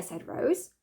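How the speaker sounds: plain reading voice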